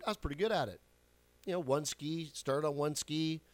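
ordinary talk

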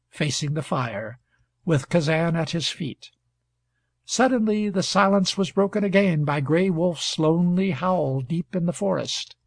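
usual speaking voice

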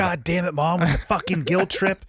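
a teasing take on a guilt-tripped son; mock exasperated retort. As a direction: play the fed-up son, hamming it up. Listener jokingly voices the son's retort.

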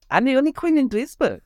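put-on high, camp German accent with a bemoaning lilt. A camp German accent, mocking the lone queen of Duisburg. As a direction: sigh it out as a camp German, pitched well up. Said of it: "a camp German".

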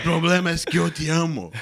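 slow, loud love confession in a put-on voice. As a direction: drag out the confession loudly. Love confession in acted scene.

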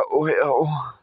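low, fading groan of an overfull sleeper; drops to a low near-whisper by the end; groggy complaint. Retold or imitated. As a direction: mumble it, stuffed and sleepy. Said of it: "It's like".

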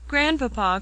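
high, tearful-edged cry; holding back tears. A narrator's reading. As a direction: cry out while fighting tears. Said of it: "holding back the storm of tears".

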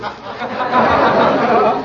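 hearty laugh. Acted. Judge laughs.

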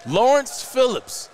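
slow, loud drawn-out name call; countdown name reveal. Acted.

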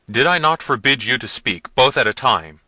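loud, stern rebuke; angry prince. A narrator's reading. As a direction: scold the servants at full, firm volume. Manfred scolds the servants.

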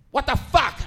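high, loud outburst of disbelief; loud shock. Retold or imitated. Shopper reacting to mother's answer, loud shock. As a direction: exclaim it loudly, punching the swear.

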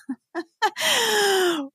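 long high giggle; amused, drawn-out giggle; laugh. Real, not acted. Laughing while telling story.

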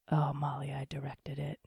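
soft whisper; whispered quote. Retold or imitated. Ty telling her he directed it, in a whisper. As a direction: murmur it modestly.

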